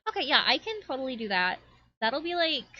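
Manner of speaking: quick, high-pitched fairy reply; eager fairy. Acted. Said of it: "And the fairy".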